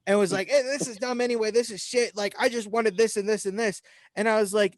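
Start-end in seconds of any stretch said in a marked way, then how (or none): 0.5-3.8 retold going off. Customer going off at the cashier, imitated higher-pitched rant. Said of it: "she started absolutely going off".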